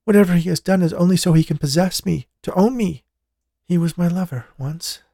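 lightly voiced young woman in fear; a woman pleading about her lover; frightened woman. A narrator's reading. Jessica voiced as character.

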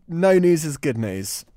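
usual speaking voice

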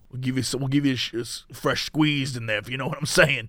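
hushed, low diner waitress role play; whispered asmr role play. Pretend. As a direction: murmur it softly like a waitress. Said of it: "it's like a diner".

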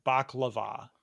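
baklava with the last syllable stressed, bak-la-VAH; baklava, weight thrown onto the final va. Shown as a demonstration. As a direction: say baklava landing on a long VAH. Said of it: "so I call it bak".

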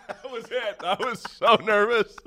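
laughing it out, high and breathless. Real, not acted. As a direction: giggle through the confession. Laughing at his own flop.

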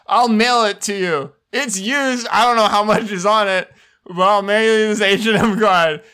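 oversell it, laughing through; ad read. Acted. Mock ad continues, laughing.

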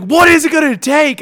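loud, high exasperated plea; retold shout of frustration; exasperated outburst. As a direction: cry it out at a tough crowd. Retold plea to woman who won't laugh.

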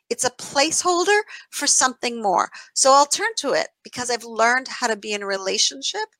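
ordinary talk, nothing marked

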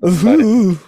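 camp ooh; high, loud camp ooh; campy drag queen. Pretend. Campy reaction after greeting.

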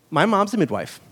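normal talking voice

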